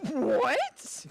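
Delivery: loud, high rising disbelief; real astonishment. Real, not acted. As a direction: blurt it out, floored by the news. Stunned by the sequel title.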